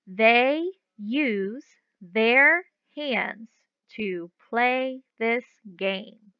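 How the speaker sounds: very slow, even reading pace; slow model reading for young readers; slow read-aloud. Shown as a demonstration. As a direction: read it slowly for the kids to follow. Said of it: "I'm gonna read the sentence the first time".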